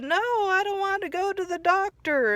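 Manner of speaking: mock scared SpongeBob. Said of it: "So that made SpongeBob scared".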